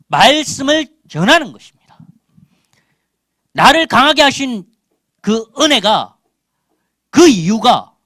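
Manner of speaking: loud, high-pitched exhortation; forceful preaching. Real, not acted. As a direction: press the point on the congregation.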